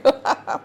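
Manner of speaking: loud laugh. Real, not acted. Laughs at her own mouthful.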